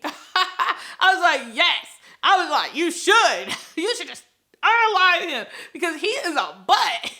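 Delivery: giggling through the cheer; laughing delight at the heroine. Real, not acted. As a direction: laugh while urging her on.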